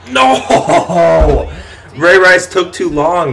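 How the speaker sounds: loud exasperated outburst at the game; frustrated yell. Real, not acted. Gamer shouting at a blown play. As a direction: yell it, then laugh at the disaster.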